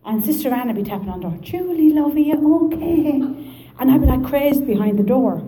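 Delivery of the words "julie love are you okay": high, slow, sing-song nun voice; concerned nun. Retold or imitated. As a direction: call sweetly through a closed door. Nun voiced calling through door.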